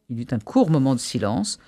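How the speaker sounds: usual speaking voice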